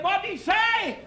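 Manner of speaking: shrill-free but high nagging question; wife nagging husband in the car, high. Retold or imitated. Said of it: "She said".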